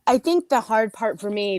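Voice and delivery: normal talking voice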